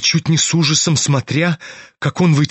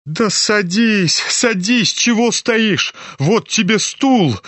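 cry out; 6 semitones higher, slower than usual. A narrator's reading. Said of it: "вскрикнула Лизавета Прокофьевна".

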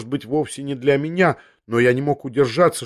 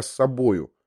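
usual speaking voice

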